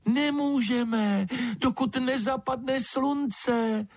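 slow, high chick-like peep; thin and piping yet cutting, drawn out slowly; thin little voice. A narrator's reading. Unseen creature questioning an intruder in the dark, thin little voice. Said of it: "hlásek tenký jako pípot ptačího mláděte".

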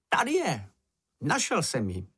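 high-pitched little cry of discovery; excited cry. A narrator's reading. Čenich announces that he has found it, high excited cry. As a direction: call out a find in a high voice. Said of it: "a nakonec vykřikl".

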